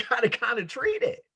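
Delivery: ordinary talk, nothing marked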